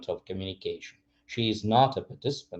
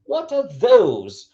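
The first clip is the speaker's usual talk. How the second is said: THOSE high, loud and stretched; emphasis on THOSE; 10 semitones higher, 6 dB louder, slower than usual. Shown as a demonstration. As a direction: point far off with a long THOSE. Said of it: "changing intonation and changing a bit, uh, the emphasis".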